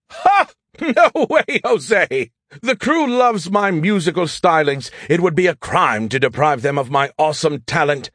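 high-pitched gleeful refusal; boastful protest. Acted. AI refusing to stop singing show tunes, boastful. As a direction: burst out high on a scornful Ha, then brag.

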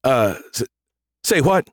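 halting, pitch leaping on the question; stuttering. A narrator's reading. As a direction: stammer in disbelief. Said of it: "Thor stuttered".

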